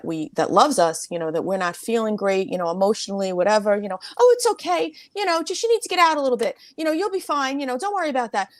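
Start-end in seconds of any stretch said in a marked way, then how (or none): 4.2-8.5 high, quick, breezy comforting; imitating a well-meaning loved one; mock reassurance. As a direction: brush it off in a high, soothing sing-song. Voicing loved ones brushing off sadness.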